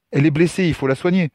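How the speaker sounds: high-pitched, pressing appeal; urgent plea. Acted. Nephew asks uncle to treat injured girl, urgent. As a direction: plead for help without delay.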